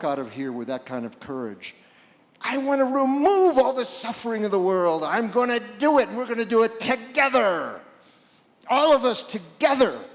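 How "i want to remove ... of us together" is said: much higher, louder rousing vow; rally the crowd to act together; fired-up call to action; rallying cry. Acted. Rallying vow voiced for audience.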